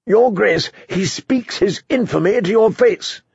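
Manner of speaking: high, indignant accusation; outraged. Acted. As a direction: denounce him to the king. Outraged knight accuses Davos to king.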